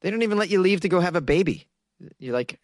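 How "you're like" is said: usual speaking voice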